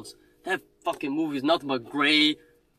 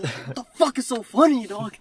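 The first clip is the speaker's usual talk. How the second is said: mock angry yell; 8 semitones higher than usual. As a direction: snap at the laughing woman, retold. Yelling at laughing moviegoer.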